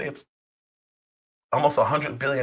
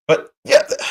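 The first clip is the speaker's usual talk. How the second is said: real voice crack from illness; sick voice cracking up high; 5 dB louder than usual. Real, not acted. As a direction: let the voice break on yeah. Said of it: "Sorry, my voice is cracking".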